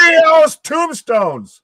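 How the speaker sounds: high, loud, drawn-out yell; excited shout. Real, not acted. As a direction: scream it at the big spot. Big spot, both yelling.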